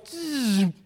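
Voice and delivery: high buzzing drill noise that dies off; drill buzz imitation. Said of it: "and it goes".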